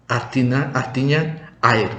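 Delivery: usual speaking voice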